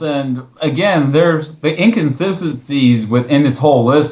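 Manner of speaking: normal talking voice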